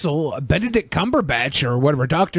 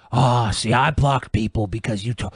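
lightly imitated low-voiced grievance; retold complaint, pitched well down; Von Helton; 8 semitones lower than usual. Podcaster quoting a man who blocked people for Brett, low hurt complaint. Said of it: "Vaughn was like".

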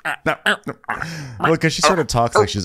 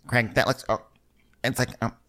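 choppy bad-connection voice; 6 semitones higher than usual. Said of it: "everything she's saying is like".

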